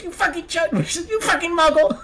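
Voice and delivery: imitating the freaking-out kid. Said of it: "where he goes".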